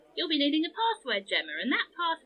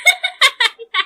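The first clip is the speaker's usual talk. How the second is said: loud, high burst of laughter; hearty laugh; 11 semitones higher, 13 dB louder than usual. Acted. Jester reacting to the girl's joke, loud laughing.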